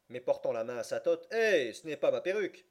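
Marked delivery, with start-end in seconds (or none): 1.3-2.6 louder, higher surprised exclamation; startled judge. A narrator's reading. Judge startled by the wrong wig. (exclamation surprise du juge, plus forte)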